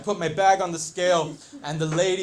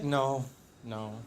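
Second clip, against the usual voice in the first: retold calm denial; 6 semitones lower, 6 dB quieter than usual. Retold gate agent's calm denial.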